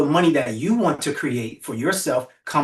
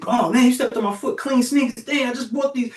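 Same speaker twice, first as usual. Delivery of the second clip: mock complaint; 7 semitones higher than usual. Complaint about stepped-on sneakers.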